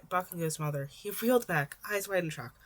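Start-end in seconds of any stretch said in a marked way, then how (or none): none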